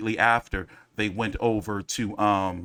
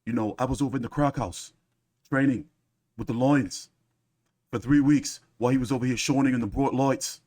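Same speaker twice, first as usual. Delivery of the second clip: lightly mocking impression of a boasting fighter; George's storytelling. Host mimicking George telling his stories, mock boastful impression. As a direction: put on a boxer's hard-luck tale, lightly mimicked. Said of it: "He'll start telling you these stories".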